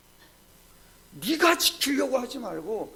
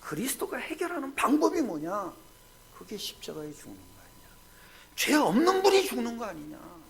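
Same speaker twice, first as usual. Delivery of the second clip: ordinary talk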